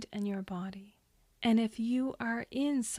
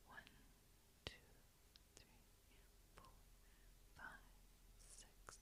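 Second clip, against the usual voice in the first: barely audible, spaced-out counting; hushed breath count; 30 dB quieter, faster than usual. Acted. Guide counts a listener's inhale, very hushed. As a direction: count very faintly, leaving room to breathe.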